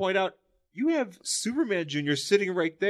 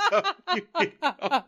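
high laughing; 8 semitones higher, slower than usual. Real, not acted. Laughing squeal.

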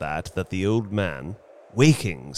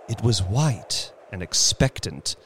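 ordinary delivery

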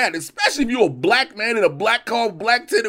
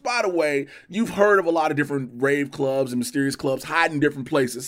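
ordinary delivery

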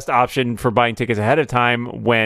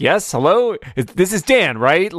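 puzzled; 6 semitones higher than usual. Retold or imitated. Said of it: "I'm like".

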